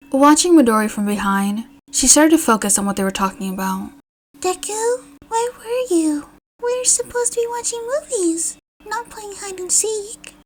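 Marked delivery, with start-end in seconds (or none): 4.4-10.3 put-on much higher, small child's voice; playful little kid teasing, softer; joking little girl. A narrator's reading. Little Eri teasing Deku for being late, playful high child voice. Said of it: "Eri joked".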